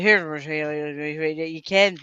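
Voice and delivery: put-on slow mumbled broad Scottish accent. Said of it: "really low voice and a really strong Scottish accent, and he used to mumble".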